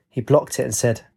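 ordinary delivery

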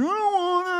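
high, loud mock whine; whiny, complaining schoolboy; grumpy son. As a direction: imitate a kid wailing in protest. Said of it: "with his bad attitudes".

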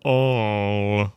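All hit hard and loud; great emphasis. A narrator's reading. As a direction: stamp on All. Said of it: "he repeated with great emphasis".

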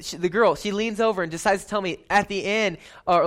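ordinary delivery

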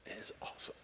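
whispered in awe; hushed awe. Real, not acted. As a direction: breathe it out, hushed. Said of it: "awed after the song".

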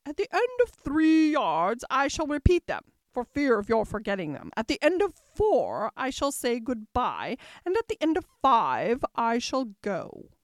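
loud, emphatic queenly announcement; pompous queen, instructing. A narrator's reading. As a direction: list the steps loudly as an imperious queen. Narrator voices the Red Queen.

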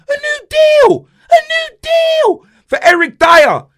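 incredulous shriek; outraged disbelief. Real, not acted. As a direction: screech it like you can't believe it. Outraged at contract idea.

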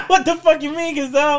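high, laughing what-the-fuck; laughing mock outrage. Real, not acted. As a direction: blurt it out through a laugh.

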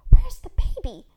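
high, urgent, forceful stage whisper; whisper-shriek it in alarm; frantic breathy question, pitched high; panicked whisper. A narrator's reading. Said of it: "in a panic whisper".

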